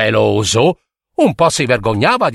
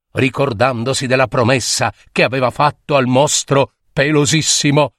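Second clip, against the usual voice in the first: usual speaking voice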